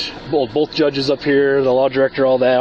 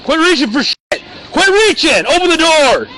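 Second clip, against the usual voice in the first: high, loud frantic commands; shouting; 5 dB louder than usual. Real, not acted. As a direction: yell urgently at someone reaching. Officer yells at suspect reaching.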